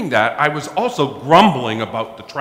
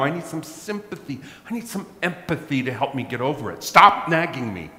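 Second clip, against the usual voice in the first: higher, irritated mock complaint; irritated plea; 9 semitones higher than usual. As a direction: whine at the nagging wife. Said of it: "I was even more irritated, and I said".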